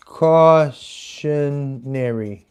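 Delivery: "Cautionary" stretched out very slowly, low; writing-along drawl. Real, not acted. Comic writing the note down, drawing out "Cautionary". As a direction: sound out "Cautionary" syllable by syllable. Sounding out word while writing.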